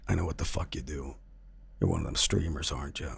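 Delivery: low, hushed, sinister stranger's voice; menacing intruder. Retold or imitated. Dream intruder confronting a streamer, quietly menacing. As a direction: play a quietly threatening intruder.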